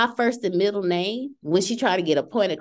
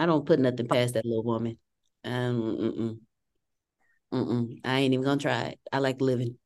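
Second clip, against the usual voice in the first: much lower, muttered joke; lowered mock fear; 11 semitones lower, 5 dB quieter than usual. Real, not acted. Daughter joking about her mother, low mock fear. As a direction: drop low like confessing fear. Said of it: "joking fear of mother".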